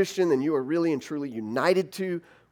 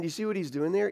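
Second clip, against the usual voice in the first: usual speaking voice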